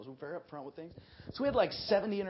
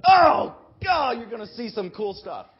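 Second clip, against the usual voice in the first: high, loud, laughing exclamation; excited shout; 6 semitones higher, 15 dB louder, slower than usual. Real, not acted. Preacher shouting to students about the fun they'll have. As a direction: burst out with delight. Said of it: "preacher exclaims to audience".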